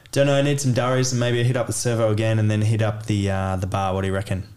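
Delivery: fast, casual Aussie mumble-through; Australian conversation. Shown as a demonstration. Demonstrating Australian chat for learners, person one, rapid Aussie slang. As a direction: run the slang together like an Australian local. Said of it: "perhaps an Australian conversation".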